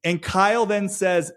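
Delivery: ordinary delivery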